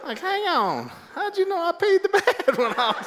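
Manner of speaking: high, laughing in disbelief; cracking up at the question. Real, not acted. Telling the audience, laughing in disbelief.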